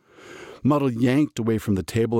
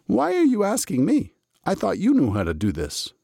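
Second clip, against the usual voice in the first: Muddle voice; 11 semitones higher than usual. A narrator's reading. Muddle's high bewildered voice.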